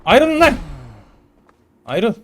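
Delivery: high, loud yelling to separate them; breaking up a fight. Pretend. Guard yelling at fighting prisoners to break it up, loud. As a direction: shout them apart.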